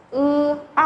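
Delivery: "Ư" held level, then "R" with curled tongue; model vowel sounds. Shown as a demonstration. Teacher reviews two finals for students, "Ư" level, "R" retroflex. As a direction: draw out "Ư", curl back for "R". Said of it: "Này đọc là Ư".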